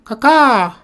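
high, loud imitated bird cry; children's loud cawing at a bird; bird-call caw. A narrator's reading. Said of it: "They called to the bird".

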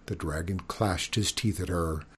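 usual speaking voice

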